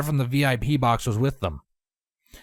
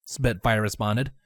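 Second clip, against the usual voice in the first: ordinary delivery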